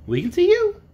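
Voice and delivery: very high tiny squeak; piglet voice. A narrator's reading. Piglet reassuring the wizard, tiny high voice. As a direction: pipe up like a little pig. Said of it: "said another of the piglets".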